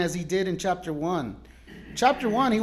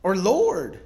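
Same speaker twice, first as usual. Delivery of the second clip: desperate prayer; 3 semitones higher, 6 dB louder, much slower than usual. Retold or imitated.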